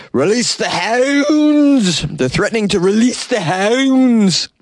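high, drawn-out villainous command; dramatic shout. Acted. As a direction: bellow it like a cartoon villain.

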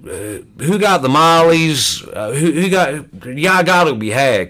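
retold suspicious rant, stumbling over words; worked-up outburst. Newcomer accusing the happy people at the meeting, worked up. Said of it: "I may end up getting loud".

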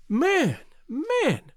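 high, urgent exclamation; anxious cry. Acted. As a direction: cry out in alarm. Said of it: "she cried".

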